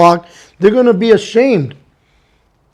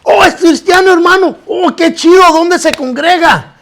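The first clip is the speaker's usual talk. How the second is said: much higher, eager greeting; excited; 8 semitones higher than usual. Retold or imitated. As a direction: gush like you met a long-lost brother. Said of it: "Yo me puse excitado, feliz". (emocionado y agudo)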